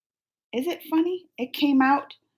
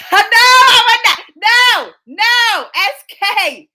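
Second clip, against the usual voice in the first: high, drawn-out shout of refusal; hammed-up shouted denial; loud mock protest; 9 semitones higher, 8 dB louder, much slower than usual. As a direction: protest far too much, loud and high. Mock-outraged denial of marrying crush.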